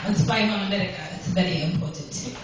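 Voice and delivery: lightly put-on Russian accent; a hint of a Russian husband's accent. Shown as a demonstration. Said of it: "I forgot the accent. I gotta do that".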